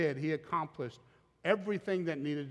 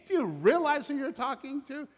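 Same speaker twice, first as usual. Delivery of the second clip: incredulous retort; 11 semitones higher, 4 dB louder than usual. Acted. Preacher voicing Jesus' retort.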